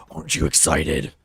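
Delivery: low, loud demonic imitation; imitating a creepy customer's sudden deep voice; deep satanic voice. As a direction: drop way down into a mock-satanic boom. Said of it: "made his voice go all deep".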